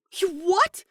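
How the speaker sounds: high, loud startled yelp; a robot's alarmed squeak of disbelief. Acted. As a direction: blurt it out in shock.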